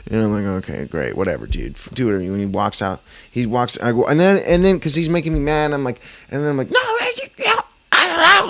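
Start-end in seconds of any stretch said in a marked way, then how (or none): 0.7-2.2 low, fed-up brush-off; dismissive. Retold or imitated. Dismissive reply in quarrel. 6.7-8.5 squeaky high retort; high mock yell. Retold argument.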